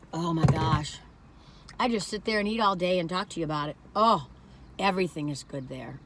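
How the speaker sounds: normal talking voice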